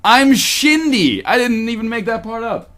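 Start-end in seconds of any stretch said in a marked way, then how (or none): 0.0-1.2 slow, higher slurred name; drunken slur. Acted. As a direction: drag it out drunk, Shindy for Cindy. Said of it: "she slurs".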